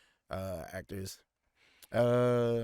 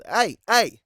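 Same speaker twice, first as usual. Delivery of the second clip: high, loud country hey; loud high country drawl; mock Randy Moss hey; country accent imitation; 9 semitones higher, 8 dB louder than usual.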